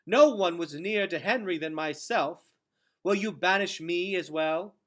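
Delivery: high, loud, tense challenge; tight voice. A narrator's reading. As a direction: push back hard, voice tight. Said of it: "her voice tight".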